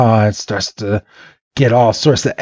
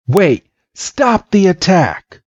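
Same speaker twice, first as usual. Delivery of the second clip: high, drawn-out call to halt; acted alarm, higher pitch; urgent plea; 8 semitones higher, slower than usual. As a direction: cry out to stop a fight. Red urgently halts the attack.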